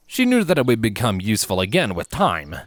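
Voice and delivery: ordinary delivery, nothing marked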